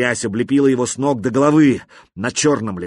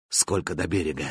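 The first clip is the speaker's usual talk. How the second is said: low, soft, weak whisper of a voice; faint dying voice; 6 semitones lower, 7 dB quieter than usual. A narrator's reading. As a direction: barely get the words out. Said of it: "спросил он".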